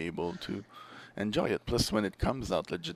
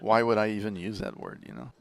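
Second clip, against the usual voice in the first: normal talking voice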